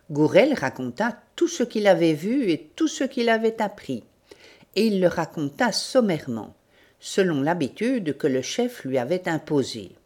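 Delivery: ordinary delivery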